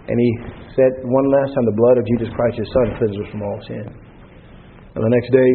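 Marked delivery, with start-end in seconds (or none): 1.7-3.8 calm deacon. Retold or imitated. Deacon's final answer.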